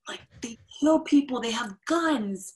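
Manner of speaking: gasp, then high alarmed mock panic; hammed-up horror about guns. Telling of the South Africans, mock horrified about guns. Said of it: "And they're like".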